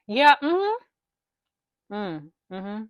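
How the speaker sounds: normal talking voice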